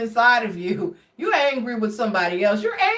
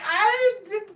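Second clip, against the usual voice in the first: high, laughing reply; 10 semitones higher than usual. Real, not acted. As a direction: say it cracking up. Laughing retelling.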